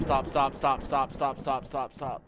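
ordinary talk, nothing marked

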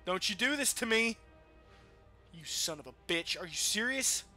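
ordinary talk, nothing marked

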